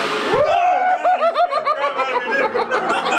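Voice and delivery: high, helpless burst of laughing; real laughter. Real, not acted. Telling viewers about the clown, real high laughter. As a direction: crack up for real at the memory.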